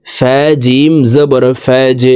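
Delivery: slow spell-out, "ফাজ" released with a bounce on j; downward push on j. Shown as a demonstration. Teacher showing jim as qalqalah, pushed j. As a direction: draw out the letters, then pop the j of "ফাজ". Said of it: "নিচের দিকে ধাবিত করে ধাক্কা দিয়ে উচ্চারণ করব".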